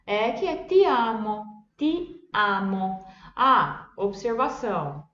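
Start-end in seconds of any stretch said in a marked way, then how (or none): 0.6-3.0 ti amo slow, hard t, open a, not nasal; Italian ti amo. Shown as a demonstration. Teacher models ti amo. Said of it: "lembra que é som aberto".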